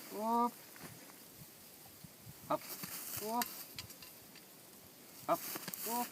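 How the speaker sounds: short high "whoop" to stop the dog; sharp dog command. Real, not acted. Trainer stopping his bird dog with a high whoop. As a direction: snap the stop word at the dog.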